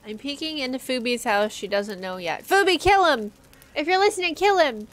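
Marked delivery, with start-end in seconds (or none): 2.4-4.9 loud and higher, urging; shouting. Real, not acted. Player yells to Fubi to kill the intruder, loud. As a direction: egg her on at full volume. Yelling at friend on stream.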